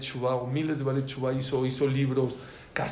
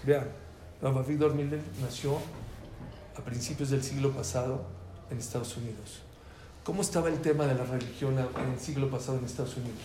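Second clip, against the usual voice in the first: ordinary delivery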